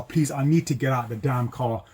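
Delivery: usual speaking voice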